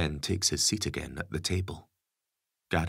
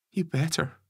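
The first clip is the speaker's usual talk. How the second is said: put-on higher young woman, insistent; daughter voice; 12 semitones higher than usual. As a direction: voice the teenage daughter.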